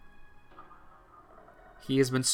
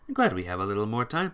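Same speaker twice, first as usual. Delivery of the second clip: ordinary talk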